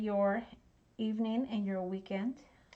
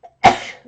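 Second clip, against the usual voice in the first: a sudden loud sneeze; sneezing; 20 dB louder than usual. Real, not acted. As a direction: sneeze loudly. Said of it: "Excuse the sneeze".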